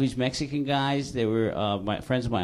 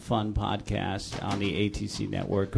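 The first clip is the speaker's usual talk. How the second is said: ordinary talk, nothing marked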